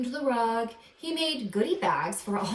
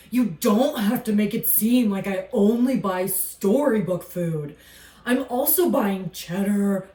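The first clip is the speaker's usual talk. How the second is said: a gruff alligator defending himself; grumpy alligator voice; 6 dB louder than usual. Acted. Snapsy the alligator talking back to the narrator, louder and grumpy.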